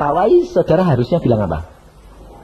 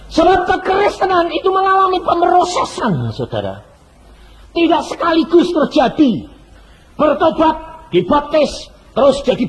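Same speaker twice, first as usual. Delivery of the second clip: preaching shout; 12 semitones higher than usual. Acted. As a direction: drive the point home to the crowd. Preacher's climax.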